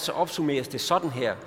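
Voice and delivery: ordinary talk, nothing marked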